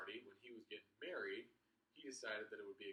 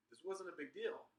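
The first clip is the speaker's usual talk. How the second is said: casual instructor; 7 semitones higher than usual. Retold or imitated. Instructor shrugging it off.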